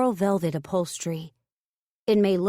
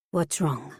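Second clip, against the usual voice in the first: suspicious; 3 semitones lower than usual. Acted. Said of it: "she says suspiciously".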